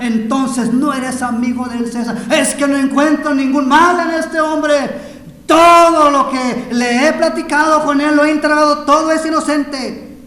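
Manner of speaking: loud, high, building argument; impassioned shouted sermon dialogue; full-force dramatic preaching. Acted. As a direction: plead the man's innocence at full volume. Preaches Pilate's argument with crowd.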